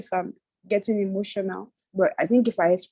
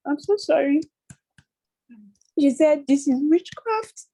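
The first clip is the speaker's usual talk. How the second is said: high-pitched, choked-up voice; real tears rising in the voice; tearful; 6 semitones higher, 3 dB louder than usual. Real, not acted. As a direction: break down as you force the words out. Breaking down while sharing hurtful message.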